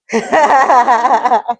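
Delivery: long, rolling chuckle; real laugh. Real, not acted. Teacher amused by the pupils' answer, laughing.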